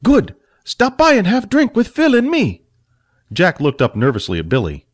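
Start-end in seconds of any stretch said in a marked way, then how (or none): 0.0-2.6 much higher, squeaky little man; a tipsy small man inviting them over; Fat, cheerfully tipsy. Acted.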